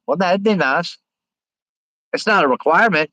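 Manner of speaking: usual speaking voice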